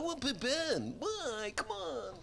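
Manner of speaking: mock a bored listener whining; whiny complaining listener. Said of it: "I know people in Chicago".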